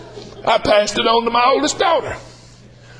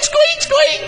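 mouse squeak. Pretend. Mocking the men in church, high mouse squeak. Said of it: "ought to go squeak".

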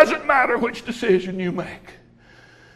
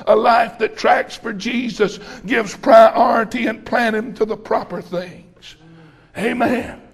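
normal talking voice

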